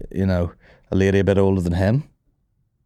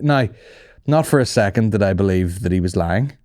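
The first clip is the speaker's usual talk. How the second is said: usual speaking voice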